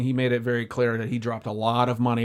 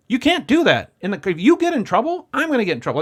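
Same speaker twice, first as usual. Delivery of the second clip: high, louder urgent scolding; 8 semitones higher, 5 dB louder than usual. Retold or imitated. Driver warning client, high loud scolding. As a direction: scold him firmly and fast. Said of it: "And I was like".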